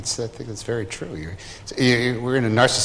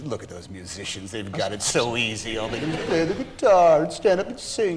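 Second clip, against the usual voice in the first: envious actors. Acted. Actors eyeing the musicians across the patio, mock envy building. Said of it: "the actors are all looking at the musicians".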